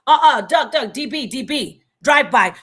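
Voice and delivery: loud urgent "duck"; retold warning shout. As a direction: yell the warning as headlights come. Calling warning at a drive-by.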